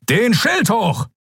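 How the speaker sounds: high, drawn-out battle order; urgent command. A narrator's reading. Admiral orders shield raised urgently.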